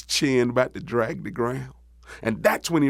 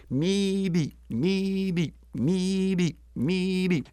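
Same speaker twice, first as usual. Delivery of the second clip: high, softer frog peeps; small, high-pitched frog chorus; little frogs; 6 semitones higher, 4 dB quieter, much slower than usual. Acted. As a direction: chirp it like tiny frogs. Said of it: "all the little frogs said".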